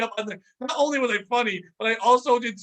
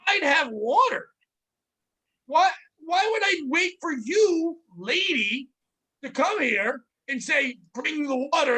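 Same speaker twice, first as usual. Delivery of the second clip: exasperated rant; 7 semitones higher, slower than usual. Retold or imitated. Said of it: "I go".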